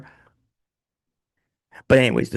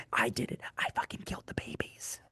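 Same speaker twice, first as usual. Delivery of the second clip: unvoiced sinister whisper; mock whisper; 12 dB quieter than usual. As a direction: whisper the confession like a villain. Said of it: "a diary that said she was evil".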